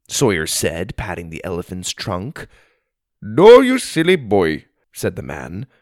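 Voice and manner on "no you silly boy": put-on higher, louder Indian-accented scolding. A narrator's reading. Elephant rider correcting a boy, Indian-accented scolding.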